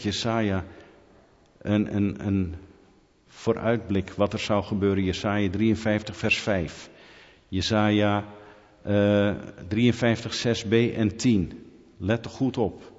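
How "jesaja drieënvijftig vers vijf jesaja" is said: ordinary delivery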